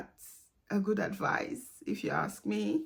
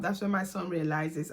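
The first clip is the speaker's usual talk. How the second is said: ordinary delivery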